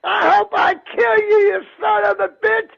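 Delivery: frantic shouting. Real, not acted. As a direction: scream at it to go away. [name] shouting the flu away.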